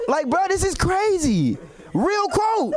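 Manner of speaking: high, laughing, half-shouted outrage; laughing disbelief. Real, not acted. Comic reacting to the president's quote, laughing and high. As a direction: laugh it out in shock.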